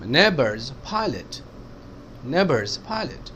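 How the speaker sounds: slow, neighbors and pilot stressed. Shown as a demonstration. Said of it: "इन दो words पे stress आया".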